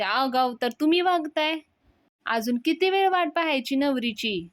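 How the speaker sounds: angry retort. Retold or imitated. Sulakshana retorting to Anna about the bride, angry. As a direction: throw the accusation right back.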